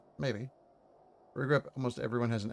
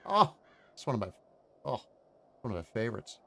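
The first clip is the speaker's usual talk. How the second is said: usual speaking voice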